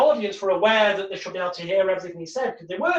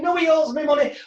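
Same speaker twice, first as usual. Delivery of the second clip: higher, louder indignant retort; a retold angry volunteer; angry protest; 7 semitones higher, 4 dB louder than usual. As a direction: insist on the debt, getting angry. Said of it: "they get more angry".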